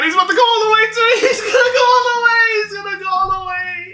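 very high, loud squealing hype; frantic high-pitched celebration; excited screaming. Real, not acted. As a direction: scream as the runner breaks free. Screaming as his player breaks away.

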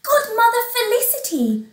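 very high, surprised exclamation; high-pitched astonished heroine; astonished cry. Acted. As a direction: cry out in amazement at the visitor. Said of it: "cried Cinderella".

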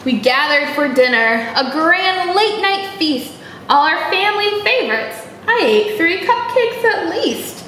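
ordinary delivery, nothing marked

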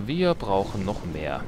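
ordinary talk

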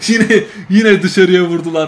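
laughing out the words as they miss; relieved laugh. Real, not acted. Gamer laughing when Benfica shoot wide again. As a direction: chuckle with relief.

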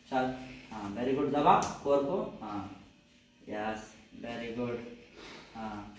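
ordinary talk, nothing marked

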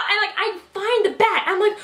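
plain reading voice

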